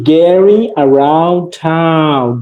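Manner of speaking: very slow, stretched-out lesson title; slow model reading. Shown as a demonstration. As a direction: draw out each word for the class.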